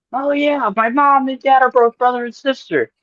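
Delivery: a light breezy guy voice; mock cheerful Mikey. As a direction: say it like it's totally normal. Mikey's cheerful reply retold.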